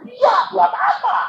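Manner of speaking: mock scornful doctor; scoffing retort.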